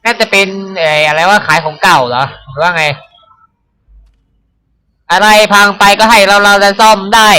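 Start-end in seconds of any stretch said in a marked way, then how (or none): 5.1-7.3 higher, louder cheerful character voice. Acted. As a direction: voice the game character, upbeat. Game character line voiced.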